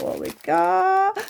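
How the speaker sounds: drawn-out high sing-song; high silly voice. Pretend. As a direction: play it high and slow. High slow silly take.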